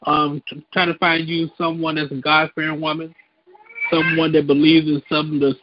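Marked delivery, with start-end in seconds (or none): none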